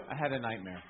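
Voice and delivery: low, soft, timid; trying not to wake anyone; meek little voice. Retold or imitated. Eldest daughter at foot of bed, soft and meek. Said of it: "she would go".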